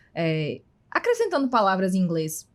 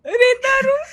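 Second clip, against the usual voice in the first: high, loud laughing mock Maltese voice; Maltese accent; 5 dB louder, much slower than usual. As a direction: shout it back like a Maltese local. Echoing the Maltese imitation. (imitando um maltês que repete alto, rindo)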